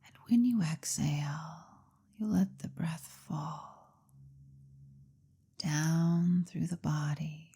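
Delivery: hypnotherapist's measured, lulling delivery; guided hypnosis. Acted. Leads a guided hypnosis session.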